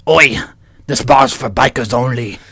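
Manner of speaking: lightly voiced bar biker. Biker warning newcomers at the bar, putting on a voice. Reading biker script in character.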